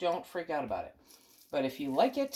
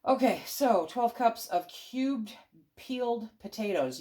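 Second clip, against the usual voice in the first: ordinary talk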